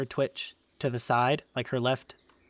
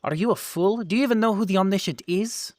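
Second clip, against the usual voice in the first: incredulous; 6 semitones higher, 4 dB louder, faster than usual. Acted. Wood elf berates the intruder.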